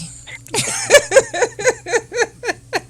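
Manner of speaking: high, breathy burst of laughter; real laugh after a cheeky plug. Real, not acted. Panelist laughing at her own plug to the host.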